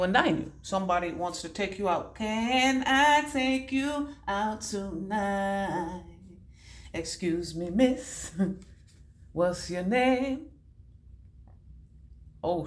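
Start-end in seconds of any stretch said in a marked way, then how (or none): 2.2-10.5 slow, drawn-out smooth talker; flirty pickup voice. Pretend. A man tries to charm a woman into a date, slowly and smoothly. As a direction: lean in and charm her slowly. Acts a man asking her out.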